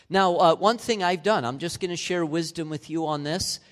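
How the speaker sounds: normal talking voice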